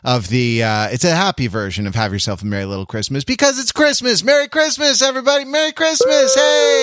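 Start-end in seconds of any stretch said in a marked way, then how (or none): 3.2-6.8 bright, much higher holiday cheer; festive greeting. Acted. Podcast host wishing listeners a merry Christmas, high and cheery. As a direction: beam out a merry Christmas to everyone.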